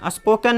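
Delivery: स्पोकन as "as-poh-ken", clear "e"; spelling reading स्पोकन, ending "ken"; mispronunciation demo. Shown as a demonstration. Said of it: "नहीं होता".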